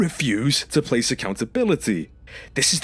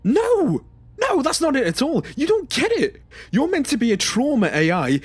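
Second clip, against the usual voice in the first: frustrated outburst; 9 semitones higher than usual. Acted. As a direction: snap back at the machine, exasperated. Martin bursts out at AI.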